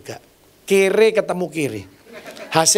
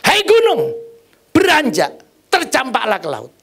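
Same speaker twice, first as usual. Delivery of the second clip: much higher, louder faith command; commanding the mountain; 6 semitones higher, 3 dB louder than usual. Acted. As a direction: order the mountain to move. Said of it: "kita suruh ngomong". (berseru memerintah gunung dengan keras dan tinggi)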